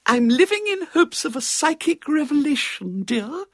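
put-on very high-pitched, affected old lady; earnest spiritualist spinster; elderly cousin. A narrator's reading. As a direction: confide your psychic hopes in a high, fluting voice. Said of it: "She was elderly and very thin".

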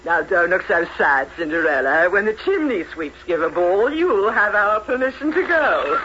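normal talking voice

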